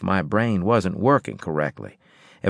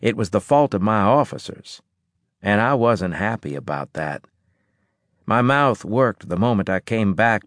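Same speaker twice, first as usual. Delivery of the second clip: ordinary delivery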